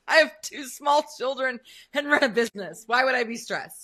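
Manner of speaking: loud dripping sarcasm; mock the idea of calm, loudly; sarcastic. Real, not acted. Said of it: "With dripping sarcasm".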